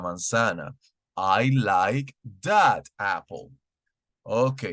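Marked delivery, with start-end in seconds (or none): 1.2-3.5 "I like that apple" said slowly, falling on "that"; slow model sentence. Shown as a demonstration. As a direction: spell the sentence out slowly for the class. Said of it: "utilizamos I like that".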